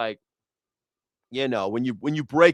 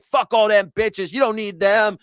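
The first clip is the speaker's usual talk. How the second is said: higher and louder, rallying a heartbroken friend; mock hype friend; 8 semitones higher, 5 dB louder than usual. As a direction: pump up your buddy with fake bravado. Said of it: "you're like".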